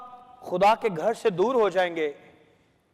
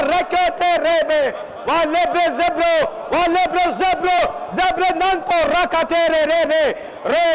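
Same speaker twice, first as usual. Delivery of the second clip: higher, intense rhythmic chant of repeated syllables; praying in tongues; 4 semitones higher, much slower than usual. Real, not acted. Preacher leading congregation in prayer, fervent speaking in tongues. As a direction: pour out insistent ecstatic syllables in driving bursts. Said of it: "यहां पे गैर जुबान में दुआ करेंगे".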